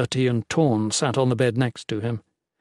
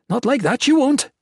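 firm; 12 semitones higher, 4 dB louder than usual. A narrator's reading. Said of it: "she said firmly".

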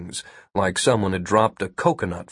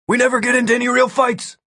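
high, fast, defensive yell; yelled excuse; 13 semitones higher, faster than usual. A narrator's reading. Ampix yells his excuse to passing Martin, high hurried yell. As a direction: call it out quickly, pitched well up. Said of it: "waited for Martin to come close again and yelled".

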